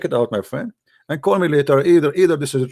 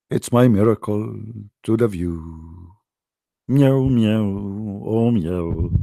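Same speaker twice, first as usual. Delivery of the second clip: slow, low, pompous bragging; mock God voice; 7 semitones lower, much slower than usual. Parodying God's miracle claims, slow low mocking voice. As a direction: boast lazily about rabbits as a smug deity. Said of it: "I can say now, like, I".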